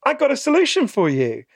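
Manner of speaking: high, bright-pitched sales patter; cheery salesman pitch. Retold or imitated. Salesman pitching a miracle fix to people, high and chirpy. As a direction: sell the quick fix like an overeager huckster. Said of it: "you say to people".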